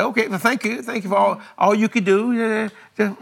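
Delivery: smiling politeness. Shown as a demonstration. Thanking the doctor politely before sending him off, smiling mock-sweetness. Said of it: "just smile".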